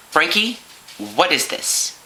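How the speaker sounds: slow, pointed questioning, drawn out; stern mom. Acted. Mom to son about the book, stern and slow. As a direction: confront a child caught out.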